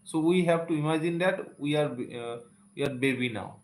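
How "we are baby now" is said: ordinary talk, nothing marked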